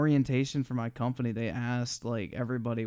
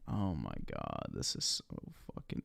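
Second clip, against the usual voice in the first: far lower, voice-changer deep; deep pitch shifted; 12 dB quieter than usual. Acted. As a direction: drop it into a pitched-down growl.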